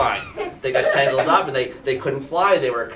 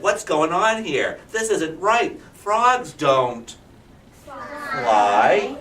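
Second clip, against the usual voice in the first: higher, louder disbelief; the turtle can't believe frogs fly; astonished old turtle; shocked turtle; 6 semitones higher, 5 dB louder, much slower than usual. Acted.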